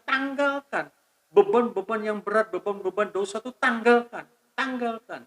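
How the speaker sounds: high, pressing pulpit exhortation; fervent preaching. Acted. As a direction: warn the congregation with rising fervour. Preaching raised, higher pitch.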